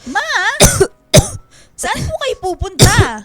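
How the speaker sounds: loud, slow, worried call. Acted. Daughter spotting her sick mother outside, loud worried calling.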